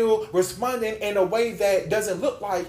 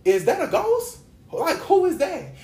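high-pitched, louder mock alarm; startled disciples; 10 semitones higher, 4 dB louder than usual. Disciples spotting Jesus walking on the water, startled. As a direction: imitate spooked onlookers, voice jumping up.

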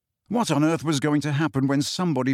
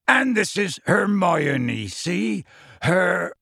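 slow, higher-pitched character voice; raised, drawn-out introduction; Hagrid introducing a friend; 4 semitones higher, much slower than usual. Acted. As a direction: present her carefully to a slow-witted giant.

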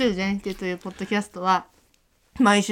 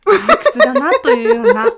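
laughing; 12 semitones higher, 9 dB louder than usual. Real, not acted. Host laughs repeating line.

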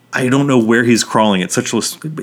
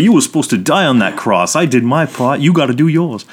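hammed-up Brooklyn accent, pitched up; 5 semitones higher than usual. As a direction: scold him in a terrible mock Brooklyn accent. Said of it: "a Brooklyn accent and everything".

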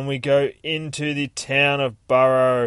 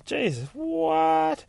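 plain reading voice

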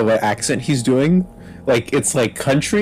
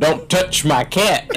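slow, drawn-out mock hero line, higher; mock tough hero; 5 semitones higher, slower than usual. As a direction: ham up the movie tough guy slowly. Mocking the film hero's line again.

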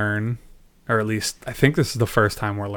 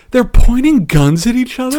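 high, loud incredulous outburst; exclaiming in disbelief; 12 semitones higher, 8 dB louder than usual. Real, not acted. Podcast host baffled by characters pointing guns, exclaiming in disbelief. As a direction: exclaim it as if it's utterly absurd.

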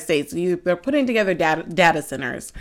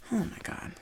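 breathy, sighing oh my God; exasperated sigh; 19 dB quieter than usual. Real, not acted. To viewers as the bra slips, a whispered sigh. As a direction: let it out on a sigh, near voiceless.

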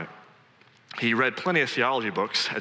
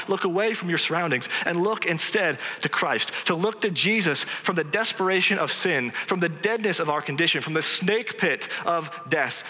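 higher-pitched, impassioned exhortation; fervent preaching; 7 semitones higher than usual. Real, not acted. Preacher urging friends to look away to Christ, fervent. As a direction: drive the appeal home with insistent fervour. Preacher's climactic appeal to congregation.

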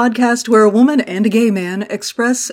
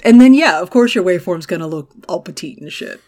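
ordinary talk, nothing marked

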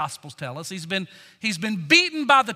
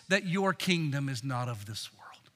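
low, near-whispered earnest appeal; quiet, intimate imploring; hushed plea; 10 semitones lower, 9 dB quieter than usual. Real, not acted. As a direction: drop right down to a soft, peaceful murmur. Preacher drops to hushed plea for peace.

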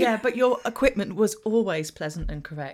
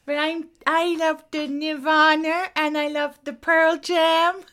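higher, louder mock American accent; American accent impression; 5 semitones higher, 6 dB louder than usual. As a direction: ham up a Kathy back from America. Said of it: "the American years?".

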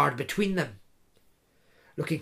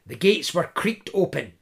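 ordinary delivery, nothing marked

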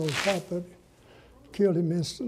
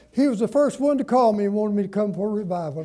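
normal talking voice

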